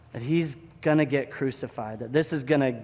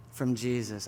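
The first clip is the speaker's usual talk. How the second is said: ordinary talk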